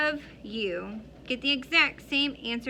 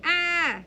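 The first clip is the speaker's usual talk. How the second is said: a short higher startled cry; imitated scream; 7 semitones higher, 6 dB louder than usual. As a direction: recall the little boy's scream.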